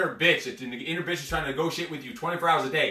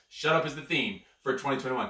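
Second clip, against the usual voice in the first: ordinary talk, nothing marked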